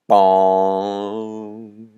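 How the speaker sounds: long hollow bong; imitated bell toll. Storyteller voicing the bell tolling in the sky, drawn-out bong. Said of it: "A bell tolled in the sky".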